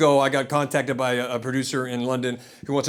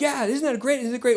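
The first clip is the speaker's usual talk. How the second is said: put-on higher, bright enthusiastic reply to a child; cheery dad; 9 semitones higher than usual. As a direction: humour the kid with delight. Dad answering excited son.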